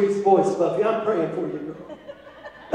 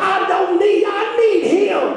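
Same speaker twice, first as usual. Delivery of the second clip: fired-up pulpit declaration, climbing higher; driving sermon climax; full-force preaching; 4 semitones higher, 4 dB louder than usual. Acted. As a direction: preach with urgent, ringing conviction.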